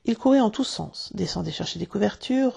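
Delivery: plain reading voice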